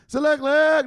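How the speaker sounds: high, loud repeated call; showman's shout; rallying call. Pretend. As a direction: holler it to seize attention. Rallies the losing fan.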